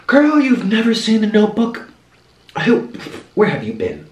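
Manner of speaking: high-pitched mock disbelief; incredulous. Man teasing his date about an unseen movie, incredulous. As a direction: act out someone amazed you missed it.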